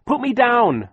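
high, loud panicked scream. Acted. Klaus held in the creature's mouth, screaming to be put down. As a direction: scream to be let go. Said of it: "Klaus screamed".

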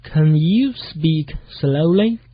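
measured, word-by-word English model; slow model reading. Shown as a demonstration. Teacher models a review sentence for learners, slowly.

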